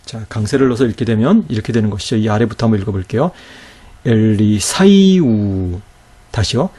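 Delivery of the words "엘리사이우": very slow 엘리사이우, 사이 joined and stressed; 엘리사이우 stretched, accent on the joined 사이; reading with stress. Shown as a demonstration. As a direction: draw it out, lifting 사이 as one glide. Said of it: "강세를 넣어서 한번 읽어보도록 할게요".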